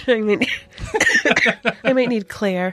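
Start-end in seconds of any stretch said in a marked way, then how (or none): 0.5-1.8 dry cough from a cold. Real, not acted. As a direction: cough mid-sentence. Sick host coughing.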